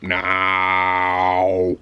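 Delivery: a hint of a slow, low robot boss; lightly voiced cold robot manager, slow and flat-out; the manager demanding the report, slow and low like a robot; robotic manager. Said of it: "Just like the possibly perfect robot".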